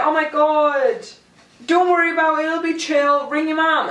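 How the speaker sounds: high, soothing reassurance; calm someone down kindly; reassuring. Retold or imitated. Neighbour reassuring crying driver.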